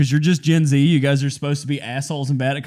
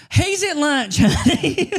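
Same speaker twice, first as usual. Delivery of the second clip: high sweet laughing voice; sing-song brush-off, much higher; laughing lady voice; mock receptionist; 10 semitones higher, much slower than usual.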